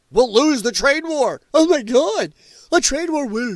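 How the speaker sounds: hammed-up fake alarm, rising; mock panic. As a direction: ham up a hysterical worrier. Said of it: "they think that".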